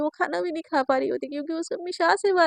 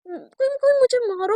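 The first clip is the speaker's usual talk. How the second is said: mock despair; 9 semitones higher, 5 dB louder than usual. Girl despairs dramatically.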